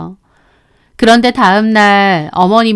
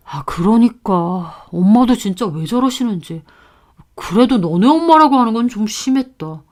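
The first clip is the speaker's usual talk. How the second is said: lightly voiced husband. Husband placating angry wife, subdued. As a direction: meekly agree then object.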